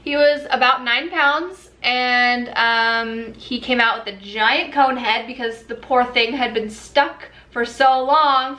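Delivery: usual speaking voice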